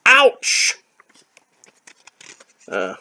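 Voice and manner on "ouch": high comic yelp. Acted. Character hits armor.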